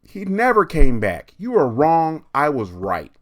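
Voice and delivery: put-on high, loud, triumphant kid; loud smug brat; gloating bratty girl. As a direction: rub it in like a spiteful child. Kathy gloating at the teacher.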